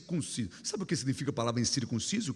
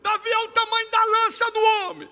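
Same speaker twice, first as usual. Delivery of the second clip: shouted taunt; 7 dB louder than usual. Retold or imitated.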